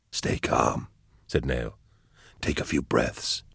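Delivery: soft whispered calm; a soldier whispering to a sick comrade. Acted. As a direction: hush it, steady and soothing. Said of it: "Why are you whispering?".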